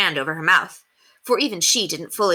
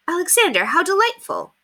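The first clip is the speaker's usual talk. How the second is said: warmly; 12 semitones higher than usual. A narrator's reading. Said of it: "she said warmly".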